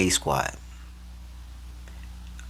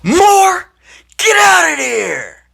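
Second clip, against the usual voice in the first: high, loud, drawn-out yell; coach yelling; 10 dB louder, much slower than usual. Retold or imitated. Coach yelling at player to leave field. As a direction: bellow his name across the field. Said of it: "just yell my name".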